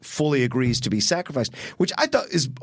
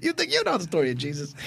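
fast, higher-pitched director impression; quick-talking filmmaker, pitched up; Scorsese; 6 semitones higher, faster than usual. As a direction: rattle it off as the famous director. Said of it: "That's Scorsese".

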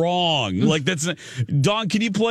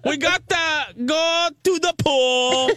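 hammed-up Chicago girl voice; Chicago accent; 6 semitones higher than usual. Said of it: "We do not talk like that".

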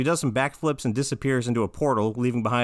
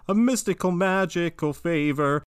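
grandiose magician; 6 semitones higher than usual. Acted. Magic Man repays Finn with a favor, grandiose magician. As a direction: draw it out like a showy conjurer. Acts Magic Man's showy offer.

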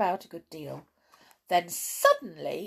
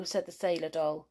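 ordinary delivery, nothing marked

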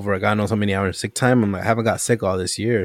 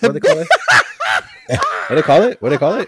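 laughing; 5 semitones higher, 5 dB louder, much faster than usual. Real, not acted. Laughing, fumbling for the word.

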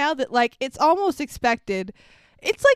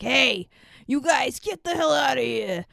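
gruff bartender. Retold or imitated. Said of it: "that's how he talks".

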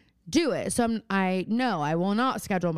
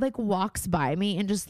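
ordinary talk

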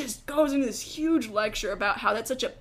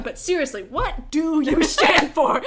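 imitating dad; 5 semitones higher, 6 dB louder than usual. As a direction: press each word, mock-serious. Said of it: "He was like".

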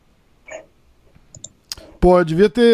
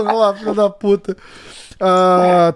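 ordinary delivery

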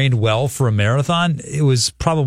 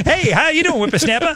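put-on high old-timer voice with a laugh; a spry old geezer calling out, laughing; old prospector. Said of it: "Is that how they would talk?".

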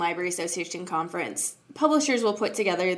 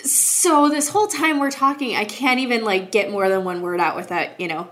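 usual speaking voice

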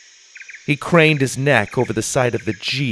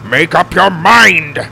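loud, higher, drawn-out demand; exasperated yell; 6 semitones higher, 7 dB louder, slower than usual. A narrator's reading. Grandpa driving amid conflicting orders, exasperated yell. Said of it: "Grandpa yelled".

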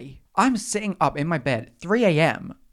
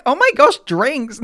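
high, loud mock squeal of excitement; excited reaction; 10 semitones higher, 8 dB louder than usual. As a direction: play up a big, giddy freak-out. Acted reaction to the invite.

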